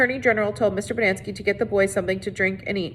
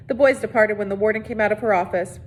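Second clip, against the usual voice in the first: ordinary delivery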